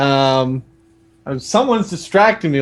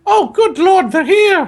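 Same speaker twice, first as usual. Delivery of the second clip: loud, high falsetto panic; frantic Aunt Petunia; 14 semitones higher, 5 dB louder than usual. Acted. Aunt hearing the doorbell, frantic falsetto. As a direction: shriek it in a flustered girl voice. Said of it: "said Aunt Petunia frantically".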